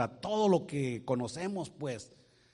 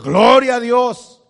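loud, high, slow cry of praise; shouted praise; 8 semitones higher, 16 dB louder, slower than usual. Acted. Believer loudly praising God after losing everything. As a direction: shout it to the rafters.

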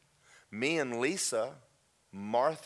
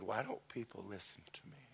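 very soft, close and intimate; barely voiced, hushed question; hushed plea; 2 semitones lower, 12 dB quieter than usual. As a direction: lean in and ask almost in a whisper. God leans close, asks into hush.

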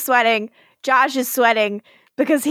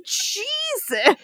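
shocked; 12 semitones higher, 4 dB quieter than usual. Real, not acted. Reacting to evil villain.

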